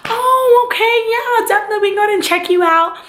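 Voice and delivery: high, bright, fast chirpy nurse. Retold or imitated. Nurse brushing off the pregnant patient, high chirpy voice. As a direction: rattle it off like an overly cheerful nurse. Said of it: "she's like".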